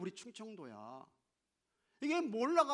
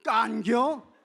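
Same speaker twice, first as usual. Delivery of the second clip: very loud, raised Chungcheong 깐겨?; Chungcheong dialect; 6 semitones higher, 17 dB louder, much slower than usual. Acted. Preacher leads congregation in the local version, Chungcheong dialect. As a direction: fire off 깐겨? as a short Chungcheong question. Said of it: "우리 충청도는 말할 거 없어".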